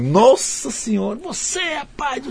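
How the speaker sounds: mock tirade in a very high, softened voice; imitando homem furioso. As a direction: squeal out his outrage in imitation, held back in volume. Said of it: "ele virou um demônio". (xingamento imitado, agudo e arrastado)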